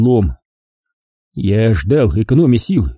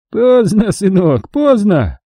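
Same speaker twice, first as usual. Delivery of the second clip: put-on giggling old man; 9 semitones higher, slower than usual. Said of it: "хихикнул старик".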